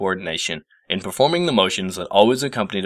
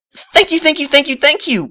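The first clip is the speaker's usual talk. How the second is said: a high rushed gush of thanks; blurted; 4 dB louder than usual. A narrator's reading. Starstruck Twilight thanks her idol professor in a high gush. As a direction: spill it out high and breathless. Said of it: "blurted Twilight".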